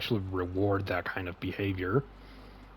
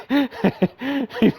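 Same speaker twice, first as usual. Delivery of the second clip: laughing; 5 dB louder than usual. Real, not acted. Laughing at donkey.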